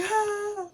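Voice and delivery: high startled cry; high gasp. Retold or imitated. Mom reacting at the birth, high startled cry. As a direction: yelp up high. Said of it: "And then I was like".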